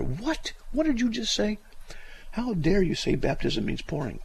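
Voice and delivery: high-pitched mock outrage; shocked listener. Voices outraged listeners spluttering over coffee.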